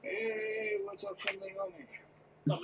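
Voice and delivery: high, slow put-on greeting; mock-friendly high voice; squeaky hammed-up hello.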